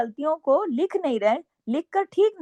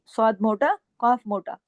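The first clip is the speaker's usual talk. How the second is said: ordinary talk, nothing marked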